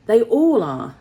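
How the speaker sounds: lightly voiced old man grumbling; old tinner. A narrator's reading. Old tinner rising to his feet, grumbling loudly.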